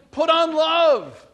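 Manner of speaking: slow, loud, every word weighted; preacher hammering the key phrase; emphatic refrain. Real, not acted. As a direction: drive the refrain home slowly. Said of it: "sermon refrain, slow and loud".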